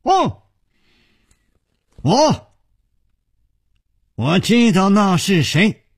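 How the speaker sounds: high-pitched, stammering exclamation; sudden outburst. A narrator's reading. Youth suddenly announcing he knows the attacker, exclaiming. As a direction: burst out high, tripping over the first word. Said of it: "黑衣少年突然大声说".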